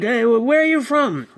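high, slurred mimicry; slurry friendly question, imitated. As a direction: put on a sloppy, high voice. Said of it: "[name]'s just sort of".

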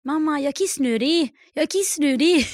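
put-on lightly high child voice needing the toilet; a little girl pleading to mum; child's Gothenburg accent. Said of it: "Selma bara".

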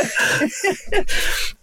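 high, airless embarrassed laugh; breathy laugh. Real, not acted. Laughing at her own embarrassment.

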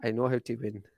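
lightly imitated Irish accent, landing near South African; Rodgers Irish accent impression. Shown as a demonstration. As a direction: do a poor Irish accent on request. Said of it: "Do it again".

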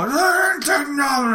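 high put-on shouting denial; whine-yell a guilty denial; thief yelling back. Shoplifter shouting back at the clerk, high mock yell. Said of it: "yelling match ensues".

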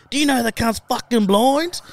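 high, loud, slow mocking shout; loud mock reveal. Foreman at the barbecue outing Dan, loud slow high shout. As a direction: bellow it out, dragging every word. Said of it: "He goes".

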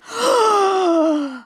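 big high theatrical gasp; mock shocked gasp. [name] spots Minx on Rio, mock scandalized gasp. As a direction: gasp long, hamming up the shock.

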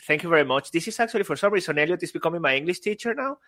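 ordinary delivery

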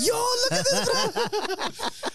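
very high, hammed-up crowd voice; everyone's excited voice. Friends crowing about the accent, very high and hammed-up. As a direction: squeal it like an amazed friend. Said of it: "everyone's like".

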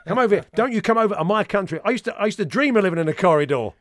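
Four Yorkshiremen. Retold or imitated. Presenter imitating Wilder's hard luck stories, mock indignant rant. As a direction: protest indignantly, hamming up the hardship. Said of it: "almost like four Yorkshiremen".

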